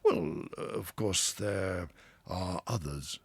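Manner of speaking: slow, reluctant start; hesitating. A narrator's reading. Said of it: "in a hesitating sort of way".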